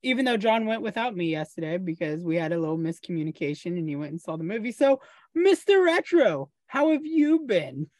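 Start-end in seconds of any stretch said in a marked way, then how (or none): 4.7-7.9 announcer voice. Acted. Hosts hand-off to guest.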